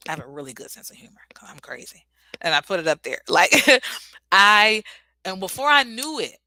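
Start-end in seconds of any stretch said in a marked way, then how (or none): 0.1-2.0 whispered. Shown as a demonstration. Affirmation whispered to herself.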